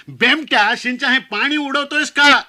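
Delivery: loud, high scolding shout; yelling uncle. Retold or imitated. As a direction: bellow it at the groom in front of everyone. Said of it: "ओरडला".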